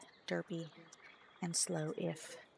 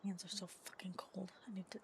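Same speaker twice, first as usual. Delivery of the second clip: hushed whisper; 12 dB quieter, faster than usual. Real, not acted. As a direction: breathe it out as a whisper. Whispered complaint, unvoiced.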